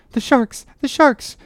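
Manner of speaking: high-pitched alarmed cry. A narrator's reading. Boy at the cabin window warns his master, high alarmed cry. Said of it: "cried a voice".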